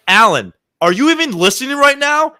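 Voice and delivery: high, loud scolding of her husband; wife yelling at husband who isn't listening, loud and high; retold yell. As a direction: snap at Alan like a fed-up wife. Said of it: "She was yelling at her husband".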